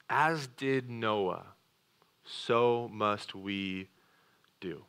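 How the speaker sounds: slow, deliberate charge; solemn charge. Real, not acted. Preacher charging the congregation to obey, slow and solemn. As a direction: drop each word slowly.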